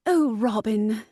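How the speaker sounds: lightly voiced character calling Robin; a touch of storybook heroine; game character voice. Shown as a demonstration.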